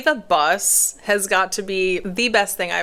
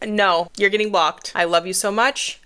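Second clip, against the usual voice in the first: ordinary delivery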